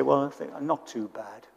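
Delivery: preacher mimicking a downbeat Brit, low and soft; gloomy Brit. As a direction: shrug it off like a glum Englishman. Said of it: "you ask a Brit and they say".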